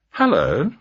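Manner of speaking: a honeyed woman's voice through the intercom; seductive. A narrator's reading. Woman answering police at the door, sweet and seductive. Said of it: "her voice almost seductive".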